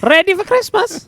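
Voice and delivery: very high, shouted put-on voice; hammed-up falsetto holler; comic turkey voice. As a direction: yell it as a silly character. Joins in the comic turkey impression.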